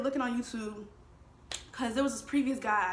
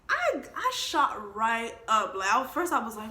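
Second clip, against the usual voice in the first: usual speaking voice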